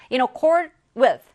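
in accord with read very slowly and loudly; slow emphatic phrase. Shown as a demonstration. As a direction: stretch each word so students catch it. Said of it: "reads a key phrase slowly". (아주 천천히 크게 읽는 표현)

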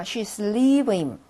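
"she's leaving" drawn out very slowly, stress on "lea"; model reading. Shown as a demonstration. Teacher rereads for students, "she's leaving" very slow. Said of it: "다시 한번 읽어볼게요".

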